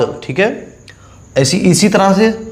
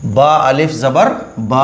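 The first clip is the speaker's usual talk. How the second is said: बा अलिफ ज़बर बा with the final बा stretched long and slow; slow, deliberate drill of बा अलिफ ज़बर बा; drawn-out reading; 2 semitones lower, slower than usual. Shown as a demonstration. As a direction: pull out the last बा like a teacher showing the rule. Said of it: "ज़बर को थोड़ा खींच कर पढ़ें".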